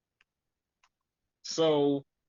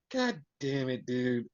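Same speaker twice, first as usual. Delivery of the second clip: disappointed; 2 semitones lower, 5 dB quieter than usual. Retold or imitated. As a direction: let it sink, quietly let down. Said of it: "he was so disappointed".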